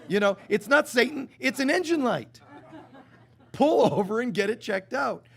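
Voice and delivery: amused exasperation. Acted. Mock exasperation at ignored warning.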